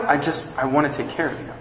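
very soft, fast, near-hushed care; hushed, hurried loving promise; tender reassurance. Acted. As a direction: murmur it quickly and tenderly to the one who hurt you. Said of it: "Jesus is saying".

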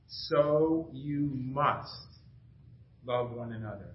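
so you must, each word drawn out very slowly. Shown as a demonstration. Pastor stresses Jesus' command to the congregation, slow and deliberate. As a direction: read it word by word, letting every word land. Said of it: "word by word".

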